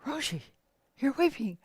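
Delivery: put-on high, loud alarmed voices of young students; shocked to find their teacher weeping; astonished boys. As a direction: boys bursting in, startled and worried. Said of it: "were astonished".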